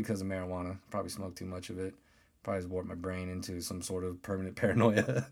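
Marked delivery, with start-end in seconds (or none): none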